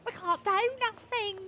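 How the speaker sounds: high mocking echo; mimicking a character. Streamer parroting a game character, high mimicry.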